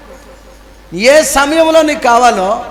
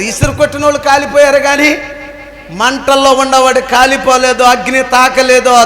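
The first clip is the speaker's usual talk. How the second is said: normal talking voice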